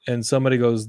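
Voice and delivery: normal talking voice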